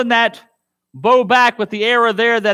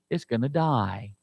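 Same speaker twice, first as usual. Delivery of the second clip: much lower and softer; hushed verdict; 9 semitones lower, 9 dB quieter than usual. Real, not acted. Preacher giving congregation the verdict, hushed. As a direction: drop it to a murmur.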